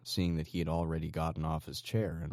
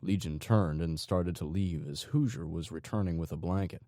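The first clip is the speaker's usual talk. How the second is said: usual speaking voice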